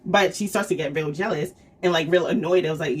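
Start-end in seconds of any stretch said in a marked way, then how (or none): none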